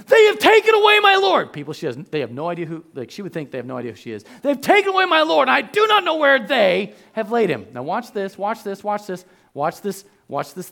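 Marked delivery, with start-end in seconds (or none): 0.1-1.5 high, loud, held wail of grief; anguished cry. Acted. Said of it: "she says to them". 4.4-7.7 higher, louder grieving plea; plead, still broken with grief. Acted. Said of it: "she says to them".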